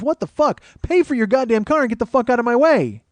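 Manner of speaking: high, loud acted fury; exasperated outburst. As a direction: blow up at the people holding up the line. Exasperated outburst at the slow queue.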